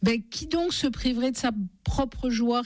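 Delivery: a scornful insect squeaking back; shrill grasshopper scoffing at the mother. A narrator's reading. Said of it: "d'une voix stridente".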